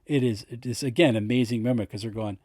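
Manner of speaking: ordinary delivery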